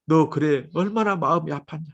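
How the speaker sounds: usual speaking voice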